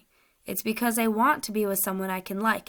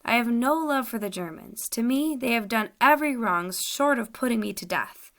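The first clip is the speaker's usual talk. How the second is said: higher and louder protest; earnest denial; 3 semitones higher, 4 dB louder than usual. Acted. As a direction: deny it hard, pleading to be believed. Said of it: "He spoke convincingly".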